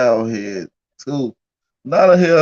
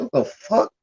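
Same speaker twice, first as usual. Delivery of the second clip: shocked; 8 semitones higher, 3 dB quieter than usual. Retold or imitated. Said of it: "I said".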